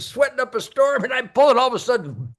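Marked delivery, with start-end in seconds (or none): none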